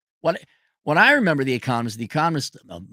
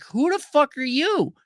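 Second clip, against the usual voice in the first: baffled; 11 semitones higher than usual. Retold or imitated. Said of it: "You're like".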